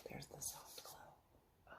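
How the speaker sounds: hushed, breathy whisper. Acted. Reading to children, hushed whisper. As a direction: whisper it like a secret.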